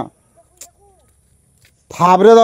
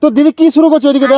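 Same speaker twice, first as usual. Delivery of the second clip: high raised call to someone far; yelling across the room; 6 semitones higher than usual. Real, not acted. Calling to sister over there. (ওদিকে দিদিকে চেঁচিয়ে ডাকা)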